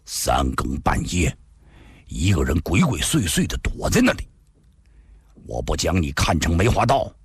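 low, cold and measured accusation; coldly. A narrator's reading. Zhao Zhengyi coldly accuses Li Xunhuan of sneaking in. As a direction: speak icily, giving nothing. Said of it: "冷冷地说道".

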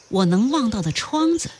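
ordinary delivery

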